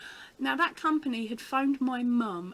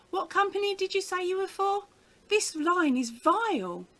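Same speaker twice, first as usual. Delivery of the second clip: high, louder mock telephone manner; put-on phone voice; 10 semitones higher, 6 dB louder than usual. Asking a cold caller to repeat, put-on high voice. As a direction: pitch it up, feigning trouble hearing the caller.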